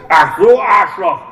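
loud, high shout at Andrea; bellowing. A narrator's reading. Biker at the bar yelling at Andrea, loud and high. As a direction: yell it out across the bar. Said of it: "brüllte".

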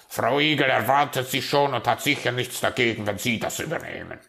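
loud, fast, then easing off; brusque raven dismissal. Acted. Raven handing the magpie an errand, brusque. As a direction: hurry her out the door.

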